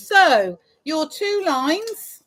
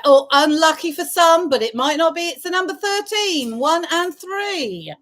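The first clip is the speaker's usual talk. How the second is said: sing-song bingo number calls; bingo caller. Acted.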